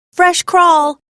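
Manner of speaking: high, loud proud call across the beach. A narrator's reading. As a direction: shout it out, proud to spot it first. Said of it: "I call out".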